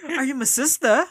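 mock British accent through laughter; laughing British accent. Retelling the stranger's question again, laughing British accent. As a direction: giggle through the British line. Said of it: "With accent yan".

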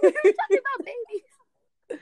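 high, loud and laughing; laughing protest. Real, not acted. Co-host laughing, correcting the topic jump, laughing. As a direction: crack up while correcting her.

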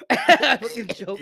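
high, slow, laughing admission. Real, not acted. After choking on his drink, laughing it out. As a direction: laugh through it. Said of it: "laughing after choking".

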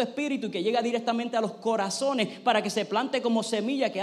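pastor's public prayer; fervent prayer. Acted. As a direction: pray aloud over the church, earnest and rising. Pastor leads congregation in prayer.

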